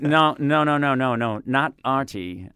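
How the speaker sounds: loud, drawn-out mock protest; insistent correction. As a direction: correct them emphatically, stretching the words. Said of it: "And I'm like".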